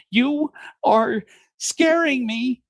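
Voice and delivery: high, slow, drawn-out fear; frightened wife. Acted. Wife clutching the slicer telling her husband she's scared. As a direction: stretch it out, terrified. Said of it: "picks up the three-in-one slicer, dicer, peeler and says".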